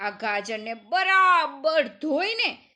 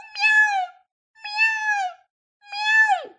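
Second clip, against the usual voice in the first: very high meows; meowing; 4 dB louder than usual. A narrator's reading. The cat Pashi comes running, high meows. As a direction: mew like a little cat. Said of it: "કરતી આવી પહોંચી".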